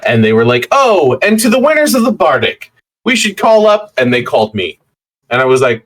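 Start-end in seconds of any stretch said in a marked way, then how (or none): 0.7-3.9 high, mock announcer call-out; imitated award announcer. Retelling being called up as Bardic winner, mock announcer.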